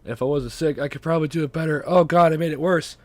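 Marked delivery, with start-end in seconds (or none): 1.9-3.0 louder, higher dismay. Real, not acted. Sick podcaster realizing they made it worse, dismayed. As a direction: cry out in dismay.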